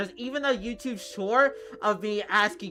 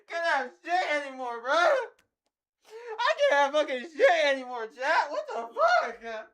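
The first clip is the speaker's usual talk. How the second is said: despairing whine; 8 semitones higher, slower than usual. Real, not acted. Whining, half laughing in despair.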